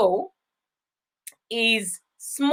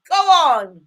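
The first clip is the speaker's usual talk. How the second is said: ordinary talk, nothing marked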